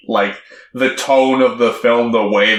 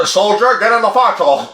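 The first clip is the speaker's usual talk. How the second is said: higher, put-on soldierly dad; mock dad order; 8 semitones higher, faster than usual. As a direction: playfully order a kid aboard. Imitating dad ordering son in.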